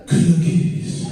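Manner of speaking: usual speaking voice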